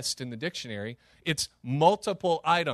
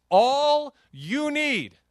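proclaimed; 9 semitones higher, 6 dB louder, much slower than usual. Acted. God's words, proclaimed emphatically.

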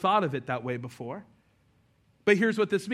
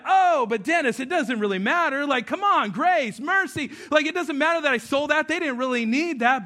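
higher, louder, whiny excuse-making; guilty man pleading with his pastor, hammed-up and higher; mock pleading excuse; 9 semitones higher, 5 dB louder than usual. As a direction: brush off the charge like a guilty kid. Pleading excuse in acted dialogue.

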